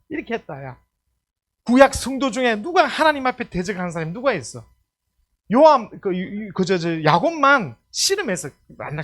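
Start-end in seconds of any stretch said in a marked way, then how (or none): none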